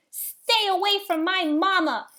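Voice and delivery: protective shout, high and loud. A narrator's reading. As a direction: yell to protect your mother. Gosling swoops in to defend.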